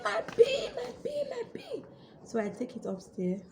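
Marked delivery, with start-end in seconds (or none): 0.0-1.8 re-enacted happy shouting. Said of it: "I was shouting".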